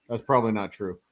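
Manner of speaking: usual speaking voice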